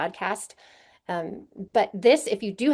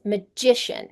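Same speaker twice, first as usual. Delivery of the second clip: "magician" with the stress on GI; second-syllable stress. Shown as a demonstration. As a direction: say "magician" as ma-GI-cian. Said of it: "The stress of the syllable changes".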